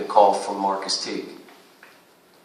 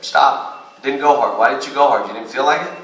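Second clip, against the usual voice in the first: sharp, louder, higher demand; coach yelling at player; 4 semitones higher, 5 dB louder than usual. Retold or imitated. Coach imitating himself yelling at a player who didn't box out. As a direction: bark the question at a lazy player. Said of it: "coach imitating himself stopping play".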